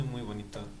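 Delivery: normal talking voice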